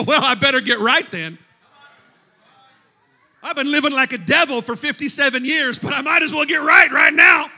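a mock sinner talking himself round, rising in pitch; last-minute sinner. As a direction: build to a loud, hammed-up decision. Acting sinner's last-minute resolve.

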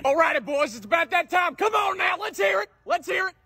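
put-on high, shouted cheer to the boys; trainer's rallying call. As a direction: whip the team up brightly. Trainer rallies the team.

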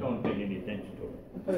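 usual speaking voice

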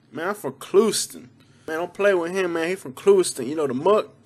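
slangy Clewiston homie banter. Acted. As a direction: play a local homie vouching for his town. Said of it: "hanging out with all the homies".

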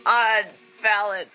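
high, loud, squealing with excitement; excited fan shriek. Real, not acted. Fan squealing at the TV over the album art. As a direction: squeal it out like you can't believe it.

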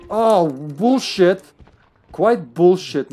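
loud, high, slow outraged yell; outraged shout. Real, not acted. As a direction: yell it out in disbelief. Scolding the zombie on screen.

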